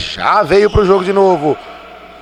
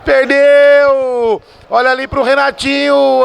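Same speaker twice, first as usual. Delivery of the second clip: excited; 10 semitones higher than usual. Real, not acted. Near chance, commentator rises.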